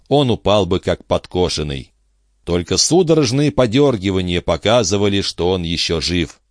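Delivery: plain reading voice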